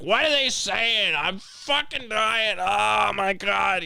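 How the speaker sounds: high, slow, wailing mock panic; mock panicked yelling. Trucker demanding news from clerk, high slow wail. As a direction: wail it high like a dying man.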